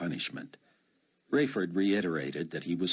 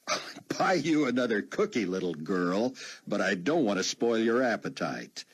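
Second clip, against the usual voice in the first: mildly hammed-up, higher-pitched retort; mock old-man roar; 6 semitones higher, 3 dB louder than usual. A narrator's reading. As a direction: play at roaring back as an old man. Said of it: "Buck roared".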